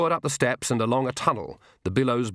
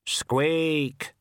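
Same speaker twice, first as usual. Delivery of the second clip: Death of Rats; 5 semitones higher than usual. Acted. Death of Rats answers the ghost, slow hollow squeak. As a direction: answer like a grim tiny reaper. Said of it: "said the death of rats".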